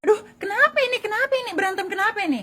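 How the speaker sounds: alarmed asking. Acted. Bystander asks the quarreling women why, high and alarmed. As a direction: fret over what the fight is about.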